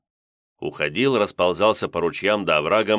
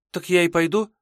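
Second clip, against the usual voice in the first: put-on girl voice; 11 semitones higher than usual. Maiden asks to go.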